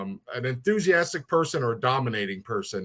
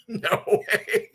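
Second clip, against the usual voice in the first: higher, laughing no way; laughing disbelief; 7 semitones higher than usual. Real, not acted. Host calls him the nicest guy, he laughs it off. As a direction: laugh out a bashful denial.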